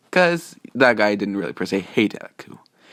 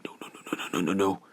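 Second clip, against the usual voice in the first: rapid whispered string of no's; under-the-breath rapid-fire refusal; hushed refusal; 8 dB quieter than usual. Retold or imitated. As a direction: murmur the no's very quickly, barely voiced. Character shakes head in refusal.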